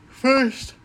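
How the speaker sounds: talking through a yawn; words stretched by a real yawn. Real, not acted. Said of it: "I'm yawning".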